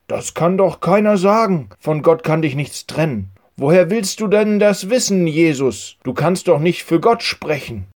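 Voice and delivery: an angry teacher challenging Jesus. A narrator's reading. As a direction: scold Jesus like a furious teacher.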